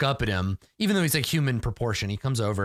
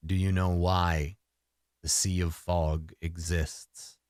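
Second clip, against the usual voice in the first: deep resonant voice; 5 semitones lower, slower than usual. Acted. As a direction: speak like an old forest god, unhurried. The deer spirit speaks again.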